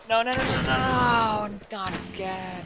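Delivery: high, loud stream of panicked no's; real panic as it blows up again; frantic pleading at the game. Real, not acted. Creeper explodes again.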